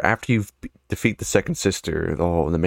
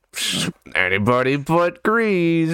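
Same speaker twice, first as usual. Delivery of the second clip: slow, higher-pitched goofy delivery; awkward dumb joke; 7 semitones higher, slower than usual. Retold or imitated. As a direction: drag out a silly quip. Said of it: "pops in with, like, some awkward, dumb joke".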